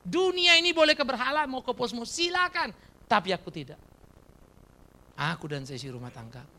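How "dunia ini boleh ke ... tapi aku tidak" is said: louder, higher rallying cry; shouting. Shown as a demonstration. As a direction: call it out as a declaration. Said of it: "Ayo berteriak". (seruan lantang penuh tekad)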